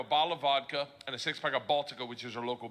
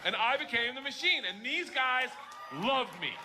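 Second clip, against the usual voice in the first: higher, almost shouting the punchline; triumphant punchline; 9 semitones higher than usual. Real, not acted. Comic closing his story, triumphant near-shout. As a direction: belt out the big finish. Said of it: "punchline delivered triumphantly".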